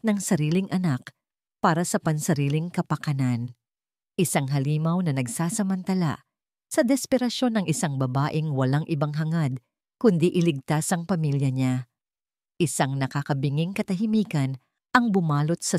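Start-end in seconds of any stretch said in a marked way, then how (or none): none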